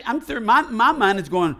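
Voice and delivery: ordinary talk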